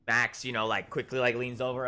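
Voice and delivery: ordinary talk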